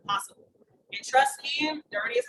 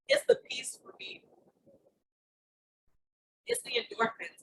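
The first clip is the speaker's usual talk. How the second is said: ordinary delivery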